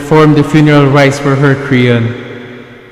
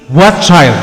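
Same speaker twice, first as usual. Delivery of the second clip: normal talking voice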